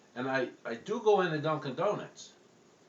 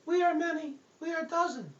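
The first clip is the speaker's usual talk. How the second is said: very high tiny demon voice; high eerie little voice; little possessed voice. Acted. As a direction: squeak it out like a small spirit. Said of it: "This little voice was coming out of me".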